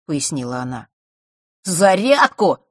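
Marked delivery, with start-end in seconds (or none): 1.6-2.6 raised, louder, angry retort. A narrator's reading. Boy repeats the word he hates, angrily. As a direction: spit it out angrily. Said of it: "зло сказал Норт".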